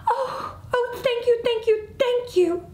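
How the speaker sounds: high, gushing mouse voice; overjoyed gratitude. Acted. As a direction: gush thanks in a high, emotional voice. Tearful mouse thanks the officer, overjoyed.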